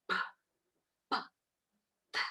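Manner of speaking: PÁ and TÁ as bare voiceless bursts, hardly any vowel; isolated phonic sounds of P and T; letter sounds. Shown as a demonstration. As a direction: say only the short consonant pop of P and T. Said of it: "ela teria que falar assim".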